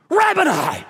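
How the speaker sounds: "Rabboni!" called out high and loud; joyful cry. Shown as a demonstration. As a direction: burst out "Rabboni!" in sudden recognition. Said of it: "I think she went".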